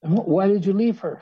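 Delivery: high, astonished question. Acted. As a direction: ask in wide-eyed disbelief. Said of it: "said the snowman".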